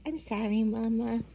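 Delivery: small, soft apology; sorry. Acted. Baby llama apologizes to mama, softly sorry. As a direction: apologize meekly under your breath. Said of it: "looks like he's sorry".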